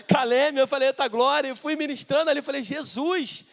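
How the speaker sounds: loud, animated outburst; excited exclamation. Retold or imitated. Pastor learning she lives in Caleme, excited exclamation. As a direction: cry out with rising fervour. Excitedly retells finding a fellow local.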